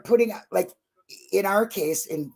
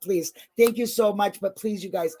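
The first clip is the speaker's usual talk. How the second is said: ordinary delivery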